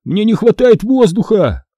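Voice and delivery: high-pitched plea for air; moaning. A narrator's reading. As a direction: cry out as if suffocating. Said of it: "застонал Кайл". (жалобно, задыхаясь)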